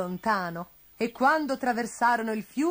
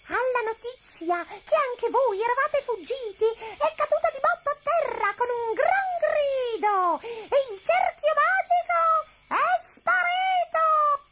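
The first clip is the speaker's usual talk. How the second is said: very high bat voice, building louder; shrieking bats; 5 dB louder than usual. A narrator's reading. Bats telling the runaways about the witch, shrieking. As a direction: screech the news, stretching the final words.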